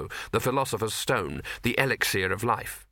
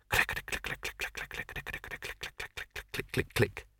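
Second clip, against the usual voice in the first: soft mechanical clicking; 8 dB quieter than usual. A narrator's reading. Mechanical clicking noise described.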